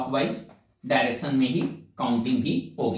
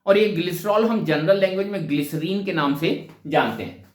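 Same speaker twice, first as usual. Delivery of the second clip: usual speaking voice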